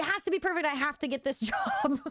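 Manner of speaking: high, urgent insistence; high-pitched insistence. Real, not acted. Anxious about the job.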